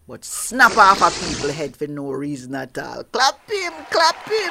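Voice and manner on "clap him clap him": high, loud urging; rally the crowd to clap; excited cheer. Acted. Host urging applause.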